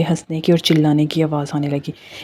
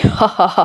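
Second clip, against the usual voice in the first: witch cackle; 3 semitones higher, much slower than usual. A narrator's reading. The witch cackles at the terrified wife, gloating. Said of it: "तभी चुड़ैल हंसने लगी".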